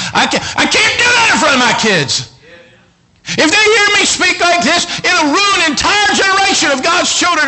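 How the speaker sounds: insistent, building to a high shout; fired-up acted vow; full-force preaching. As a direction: preach the resolve up into a yell.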